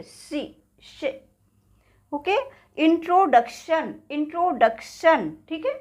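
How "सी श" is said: "सी, श" hissed unvoiced and slow; whispered hiss showing the sound; whistling s sound. Shown as a demonstration. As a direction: breathe out a long soft hiss for "सी, श". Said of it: "जिससे सीटी की आवाज़ आती है".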